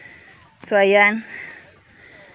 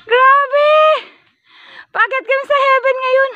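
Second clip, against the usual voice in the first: laughing; 14 semitones higher than usual. Real, not acted. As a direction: laugh it out, exasperated. Laughing at the tough climb.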